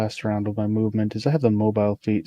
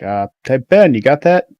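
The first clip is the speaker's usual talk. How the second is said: calling out; 2 semitones higher, 7 dB louder than usual. Acted. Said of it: "is gonna be like".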